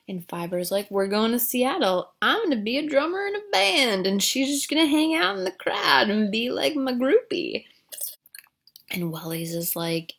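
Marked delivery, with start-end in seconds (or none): 0.9-7.7 high, lilting mock-dopey voice; dreamy naive boyfriend. Boyfriend telling his host about band dreams, high naive voice. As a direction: put on a high voice for a starry-eyed kid.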